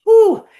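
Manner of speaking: a loud, high whistling whew; impressed whew. Real, not acted. Host reacting to the book Die with Zero, loud whew. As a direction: blow out a big impressed whew.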